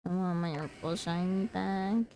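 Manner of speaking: ordinary delivery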